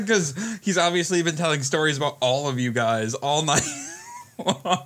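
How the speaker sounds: ordinary delivery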